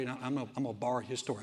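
ordinary delivery

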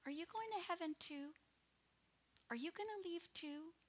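worried little daughter. Retold or imitated. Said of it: "she sits on the couch and she goes".

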